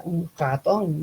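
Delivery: "ratón" with a French throaty uvular R. Shown as a demonstration. Teacher demonstrating a French speaker's R in "ratón". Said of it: "digamos que sea francés".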